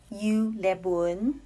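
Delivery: "Yu Le Bun" said slowly in Taiwanese; Taiwanese pronunciation. Shown as a demonstration. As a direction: say "Yu Le Bun" slow, Taiwanese tones. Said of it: "In Taiwanese dialect, my name would be".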